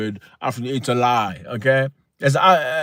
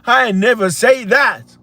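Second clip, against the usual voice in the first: put-on American accent; 10 semitones higher, 5 dB louder than usual. African host playfully sounding like Americans, mock American accent. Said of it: "sound like you, uh, you know, an American accent".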